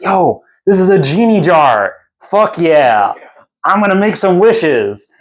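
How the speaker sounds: a giddy bard who found treasure; excited bro voice. Retold or imitated. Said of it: "he's like".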